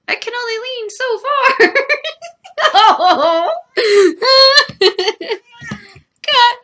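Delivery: high laughing as she tips over. Real, not acted. Nearly falling out of frame, laughing high. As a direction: laugh helplessly. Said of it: "laughing while falling".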